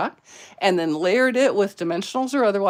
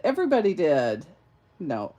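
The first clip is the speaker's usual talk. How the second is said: ordinary talk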